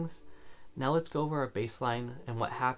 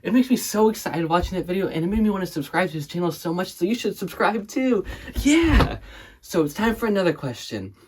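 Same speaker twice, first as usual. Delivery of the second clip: loud, high-pitched hype host; over-excited interviewer; 8 semitones higher, 12 dB louder than usual. Acted. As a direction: gush like a wildly enthusiastic interviewer. Plays hyped-up interviewer.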